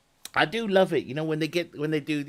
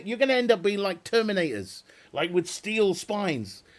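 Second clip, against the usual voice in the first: normal talking voice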